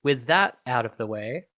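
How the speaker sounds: normal talking voice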